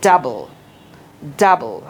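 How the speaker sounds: "ডাবল" with an open "da"; correct pronunciation. Shown as a demonstration. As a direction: say "ডাবল" as dabble, not dobble. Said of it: "শেষেরটা যেটা আছে ছিল হলো". ("ডাবল" খোলা আ দিয়ে)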